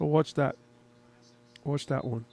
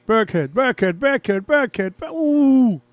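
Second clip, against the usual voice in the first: excited play reaction; 5 semitones higher, 5 dB louder, slower than usual. Real, not acted. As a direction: chant the name louder, rising into a high ooh. Calling a player during live play.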